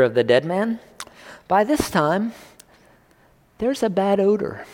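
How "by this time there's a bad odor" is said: higher, wrinkled-nose whiny Martha voice; mock Martha complaining. Martha warns Jesus about the tomb, in a higher whiny voice. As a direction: play Martha fussing about the smell.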